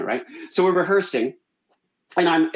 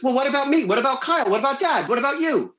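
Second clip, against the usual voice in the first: high-pitched rapid list of demands; pestering sister; 11 semitones higher, faster than usual. Retold or imitated. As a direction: imitate a sister nagging, each question punched. Sister's pushy questions acted out.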